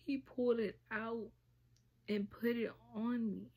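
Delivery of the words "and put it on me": ordinary delivery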